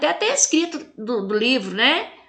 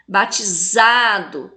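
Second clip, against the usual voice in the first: "Batizado" slowly, ZA drawn out; long, emphatic ZA in "Batizado"; tonic syllable stressed; 3 semitones lower, slower than usual. Shown as a demonstration. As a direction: punch and hold ZA in "Batizado". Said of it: "fala com mais intensidade".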